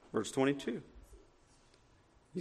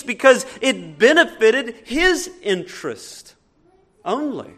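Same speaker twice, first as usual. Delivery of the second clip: louder, rising preacher's indictment; full-force preaching; 6 semitones higher, 11 dB louder than usual. Acted. Pastor preaching about Judas to the church, full-force preaching. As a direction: condemn the hypocrite from the pulpit.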